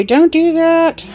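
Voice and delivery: high, loud, slow scolding; stern warning. Retold or imitated. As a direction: scold like a safety rule. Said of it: "Safety would say".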